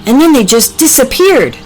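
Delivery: high, excited storybook animal; eager, animated character voice; astonished Packy. Acted.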